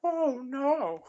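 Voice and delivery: high, loud falsetto lament; Paul Bearer impression. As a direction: wail it in mock dismay. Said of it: "he went".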